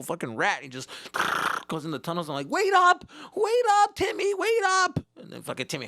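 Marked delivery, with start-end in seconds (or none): 2.5-5.0 put-on high, loud desperate kid yell; calling out. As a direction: scream after a kid who's running away. Kid calling after fast kid.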